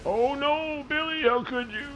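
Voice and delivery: high falsetto wail, hammed up; mock dismay. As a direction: squeal it in pretend horror, very high. Mock dismay at game mishap.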